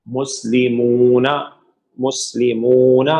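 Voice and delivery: مسلمون said slowly with a long "oo" before the final na; -una final alongado. Shown as a demonstration. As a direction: stretch the ending of مسلمون into "muslimoo-na". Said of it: "اونا کی sound آئے گی".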